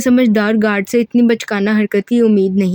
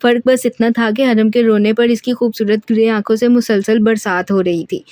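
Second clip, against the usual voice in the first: ordinary delivery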